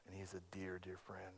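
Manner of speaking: whispered, choked tenderness; a real whisper of affection. Real, not acted. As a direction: breathe it out, barely voiced. Emotion over lost friend.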